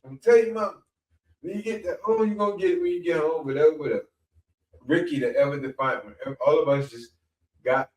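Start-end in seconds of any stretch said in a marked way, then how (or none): 0.0-4.1 higher preacher threatening to tell mom; mock-threaten like a riled reverend; scolding pastor. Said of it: "he said, he said".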